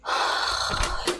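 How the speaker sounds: long, voiceless sigh; soft drawn-out exhale; heavy sigh. Real, not acted. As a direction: let out a long breath. Bracing herself in the dark.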